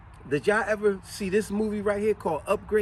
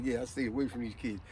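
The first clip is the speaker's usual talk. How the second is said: quiet gloating; 6 semitones lower, 8 dB quieter than usual. Retold or imitated. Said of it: "He said".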